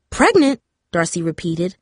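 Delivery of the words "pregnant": shocked echo that comes out too loud; startled louder repeat. A narrator's reading. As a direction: blurt it out higher and louder, caught off guard. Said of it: "louder than she meant to".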